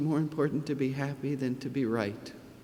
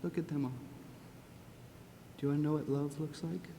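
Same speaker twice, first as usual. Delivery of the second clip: much softer, tender and close; 12 dB quieter than usual. Real, not acted. Tenderly showing the child the crowd.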